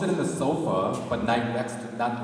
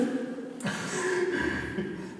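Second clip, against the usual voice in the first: high laugh. Real, not acted. Laughing at own parody.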